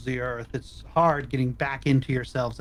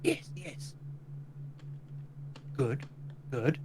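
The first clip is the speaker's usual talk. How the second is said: distant, ghostly and much softer; faraway ghostly voice; 10 dB quieter, slower than usual. Acted. Lich murmurs approval to the party, ghostly and distant. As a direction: call from very far off like a ghost. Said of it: "Exathanter's faraway ghostly voice going".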